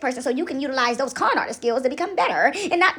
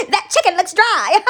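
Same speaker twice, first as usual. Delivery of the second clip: loud, slow, high jeer; drawn-out teasing through laughter; mock taunt; 4 semitones higher, 7 dB louder, slower than usual. Taunting critics.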